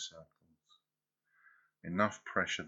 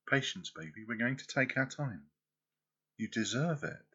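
ordinary delivery

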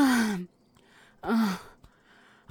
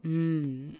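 ordinary talk

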